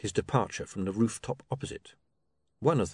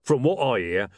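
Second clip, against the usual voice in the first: louder grumbling complaint; complaining; 3 semitones higher, 5 dB louder than usual. A narrator's reading. Mister Tulip complains to his partner about the ruler, loud and grumbling. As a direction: grumble loudly about a useless man. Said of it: "he complained".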